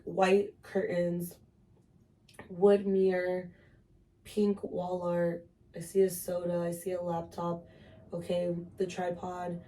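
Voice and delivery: slow, spaced-out naming of objects; slow grounding list. Shown as a demonstration. Showing a friend how to ground herself, slow and calm. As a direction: calmly list what you see, one item at a time. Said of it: "you start to describe".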